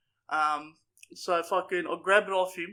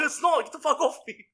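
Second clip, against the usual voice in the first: louder, higher mock yell through a laugh; retold yell; 8 semitones higher, 6 dB louder than usual. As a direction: push him off, half laughing. Retelling yelling at partner.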